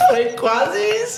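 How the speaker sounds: high laughing reply; giggling, half agreeing. Real, not acted. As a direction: laugh through the words. Laughing at friend's joke.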